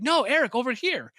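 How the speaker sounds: high, loud call for Eric; imitating the customer calling from the backyard. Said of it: "She's like".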